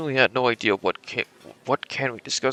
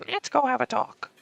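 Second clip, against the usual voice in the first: put-on high quavering old lady voice; 13 semitones higher than usual. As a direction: speak like a frail old woman. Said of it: "do the fucking old lady voice".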